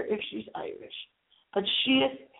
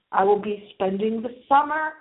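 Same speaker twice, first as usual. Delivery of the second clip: ordinary talk, nothing marked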